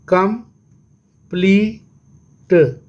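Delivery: very slow "complete" as कं, plea, ट; long pauses inside complete, final ट sounded alone; syllable by syllable. Shown as a demonstration. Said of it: "इसको कितने syllable है". ("Complete" बहुत धीरे, तीन टुकड़ों में)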